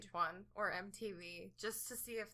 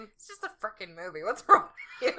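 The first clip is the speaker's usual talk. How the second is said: mock telling-off, louder at the end; self-scolding; 13 dB louder than usual. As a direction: scold yourself for tearing up. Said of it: "I remind myself when I start getting a little emotional".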